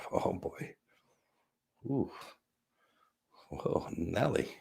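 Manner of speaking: barely audible, breathy whisper after a hit; hushed reaction. Real, not acted. Host reacting to a dab hit, hushed. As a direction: murmur it very softly, reeling from the hit.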